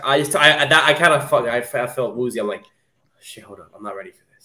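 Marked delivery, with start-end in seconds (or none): 3.2-4.5 much softer, nearly under the breath; hushed shit, hold up; stunned murmur. Retold or imitated. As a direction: mutter it to yourself, shaken. Retold own stunned reaction.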